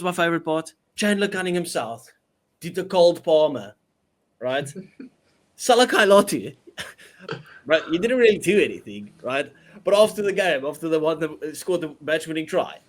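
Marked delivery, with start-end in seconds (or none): 5.1-7.8 laughing. Real, not acted. Joking about a rival's celebration.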